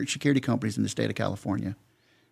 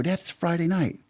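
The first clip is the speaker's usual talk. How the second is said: high-pitched, incredulous retold objection; protesting; 8 semitones higher than usual. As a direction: plead common sense with your dad. Retells protesting firings to dad.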